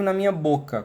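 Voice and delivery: usual speaking voice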